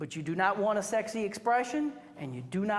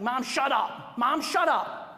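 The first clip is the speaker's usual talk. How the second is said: mock bratty teen yelling at mom, a bit louder; rude teen snapping; 4 semitones higher, 5 dB louder, slower than usual. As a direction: snap at mom like a rude teenager. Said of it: "the senior says something like".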